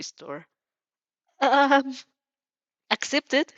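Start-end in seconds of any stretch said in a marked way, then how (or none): 1.4-3.5 high, loud panicked yell; screaming inner voice. A narrator's reading. Your mind screams at you to take it. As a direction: shout it from inside your head. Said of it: "your mind screams".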